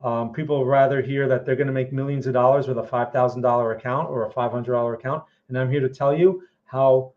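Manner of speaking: usual speaking voice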